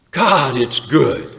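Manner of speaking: slow, loud, drawn-out cry of joy; echoed yell. Retold or imitated. Recalling his cry among the wings, slow loud joyful call. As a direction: relive the shout, savouring each word. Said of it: "what I yelled with my mouth full".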